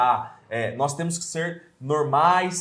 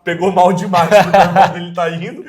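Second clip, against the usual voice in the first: louder, laughing through the words; 4 semitones higher, 8 dB louder than usual. Real, not acted. Talking about his friend's brother, laughing loudly. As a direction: chuckle as you picture it. Laughing while imagining reactions.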